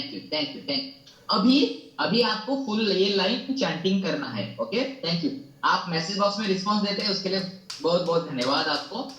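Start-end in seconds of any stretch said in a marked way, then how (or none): none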